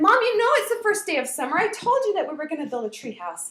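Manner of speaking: high, loud whine at mom; child's protest. Retold or imitated. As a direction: plead your case, indignant.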